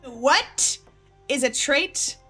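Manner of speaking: normal talking voice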